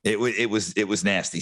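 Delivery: ordinary talk, nothing marked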